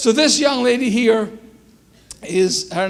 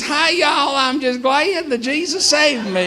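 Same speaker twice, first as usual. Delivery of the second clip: high, hammed-up Southern twang; mock Southern US accent; 5 semitones higher than usual. Joking to the audience about kids talking like y'all, mock Southern accent. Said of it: "I don't talk like y'all".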